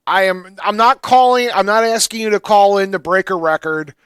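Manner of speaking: ordinary talk, nothing marked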